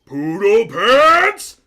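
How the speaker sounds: very high, loud, stretched-out mayor voice; a tiny piping voice for a big man, dragging the name out; mismatched mayor voice. Acted. Mayor greeting Cliff, in a squeaky, booming voice. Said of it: "voice does not match his stature".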